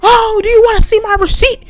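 high-pitched, loud mock indignation; customer going off. Customer defending herself against a theft accusation, going off. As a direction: imitate an outraged customer, pitch way up. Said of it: "she just started going off".

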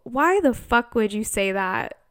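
usual speaking voice

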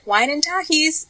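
high and slow, drawn out; high sing-song. Retold or imitated. Her guilty pleasure answer.

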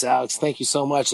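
usual speaking voice

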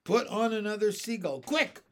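high, loud command; urgent order. A narrator's reading. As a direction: call out in a hurry.

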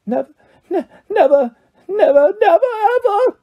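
high, sobbing elf wail; tearful wail. A narrator's reading. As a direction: build from stammered sobs to a piercing cry. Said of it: "he wailed".

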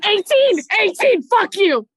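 loud high shout of the roll; triumphant shout. Real, not acted. Player shouts the roll at the game master, triumphant. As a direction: yell the number in real triumph.

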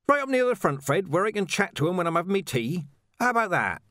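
very high-pitched, eager pleading. A narrator's reading. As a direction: wheedle him, pitch leaping way up. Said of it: "Ledgy pleaded".

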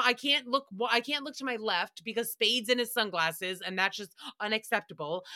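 ordinary delivery

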